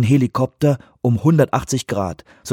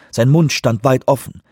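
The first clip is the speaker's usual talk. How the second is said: usual speaking voice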